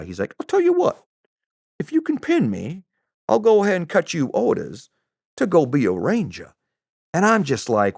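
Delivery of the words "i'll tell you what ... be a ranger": high, lilting mock Southern voice; Southern accent. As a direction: pitch it up as a Southern gent making a wager. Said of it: "I don't have a very good Southern accent".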